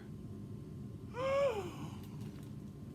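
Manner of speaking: sharp breathless gasp; shocked gasp. Acted. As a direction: gasp in alarm. Granny shocked in story.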